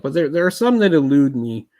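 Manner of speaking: ordinary talk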